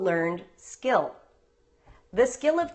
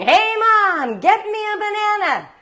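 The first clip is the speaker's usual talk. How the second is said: high, loud mock call from another room; a kid demanding a snack; child yelling; 10 semitones higher, 4 dB louder than usual. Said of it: "Noah is yelling from the other room".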